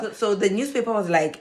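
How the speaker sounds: usual speaking voice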